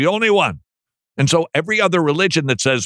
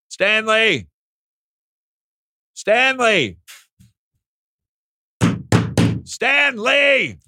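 calling out; 8 semitones higher than usual. Pretend. Calling co-host by fake name.

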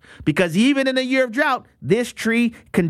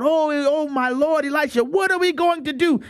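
higher-pitched mock-panicked plea; frightened cry; 6 semitones higher than usual. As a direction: cry out to your master in alarm, acted. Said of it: "And he cries out".

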